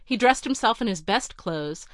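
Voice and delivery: ordinary delivery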